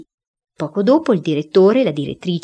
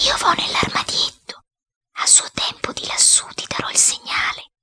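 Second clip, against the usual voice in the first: secretive, voiceless whisper; whisper in the ear; 3 dB quieter than usual. A narrator's reading. Boy telling his accomplice the plan at night, whispering. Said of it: "gli dissi all'orecchio".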